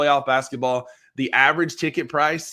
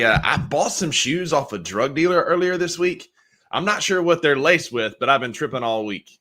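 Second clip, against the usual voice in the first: usual speaking voice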